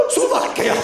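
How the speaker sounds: sharp high gasp, then hissed Slovakia; mock politician panic. Politician seeing a diverse crowd, mock horrified gasp. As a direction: gasp in horror then blurt. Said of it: "he would see all of you guys and go like".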